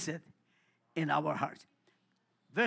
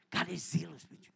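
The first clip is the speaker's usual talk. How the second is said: normal talking voice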